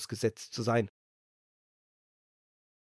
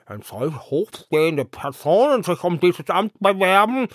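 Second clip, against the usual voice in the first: grumbling herring head; 5 semitones higher, 12 dB louder than usual. A narrator's reading. The herring head grumbling about the star, loud. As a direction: complain in a put-on voice.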